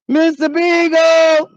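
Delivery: high, long-stretched holler; calling out. Pretend. Owner calling missing dog, long drawn-out high holler. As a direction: stretch the name slowly and call it high.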